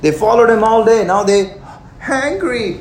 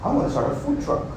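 scheming entrepreneur; 7 semitones higher, 8 dB quieter, faster than usual. Retold or imitated. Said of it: "he's like".

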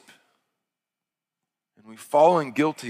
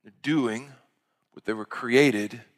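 lightly voiced Jesus calming a worried listener; gentle reassurance; 3 dB quieter than usual. Pastor voicing Jesus to the worried, softly reassuring. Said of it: "He's saying".

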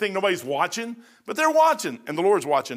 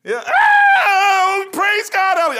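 much higher, much louder shout of praise; excited praise shout; 11 dB louder, much slower than usual. Shown as a demonstration. Preacher showing the congregation how to shout praise, loud and high. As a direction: show them how church should cheer. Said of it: "How come y'all aren't doing that in church?".

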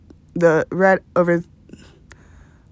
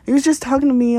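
ordinary talk, nothing marked

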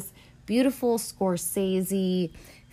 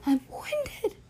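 much higher, tired squeak; squeaky winded. Real, not acted. Speaker joking to listeners about being winded. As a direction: squeak it out, out of breath.

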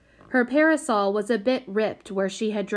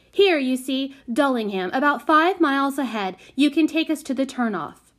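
bossy; 6 semitones higher, 4 dB louder than usual. A narrator's reading. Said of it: "in her bossiest voice".

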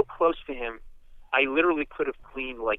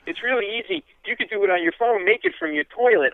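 pitched way up, rushing the pitch; a flustered fan overselling; nervous babble; 9 semitones higher than usual. Retold or imitated. Pitching his podcast to [name] in high nervous babble.

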